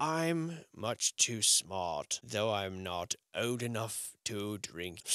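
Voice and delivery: very slow, drawn-out smug mock voice; mock the condescending kid slowly; smug Reddit know-it-all. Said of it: "said some dude on Reddit".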